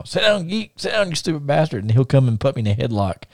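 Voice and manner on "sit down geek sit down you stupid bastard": high-pitched mock country heckle; Bunkhouse Buck. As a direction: toss out the catchphrase in imitation. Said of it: "first thing I say to him".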